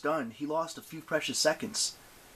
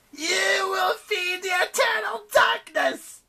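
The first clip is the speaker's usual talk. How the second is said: loud, high, slow screech; drawn-out, high-pitched monster howl; menacing goddess; 7 dB louder, slower than usual. A narrator's reading. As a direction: shriek a curse, drawing out each word. Goddess pronounces doom.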